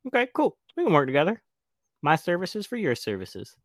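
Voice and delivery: high, loud, breezy sellout voice; sudden cheerful agreement. Retold or imitated. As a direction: flip to an eager high voice, all smiles. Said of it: "And he's like".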